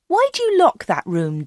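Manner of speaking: high-pitched, inquisitive question; curious Anna. A narrator's reading. Anna questions Dave, acted.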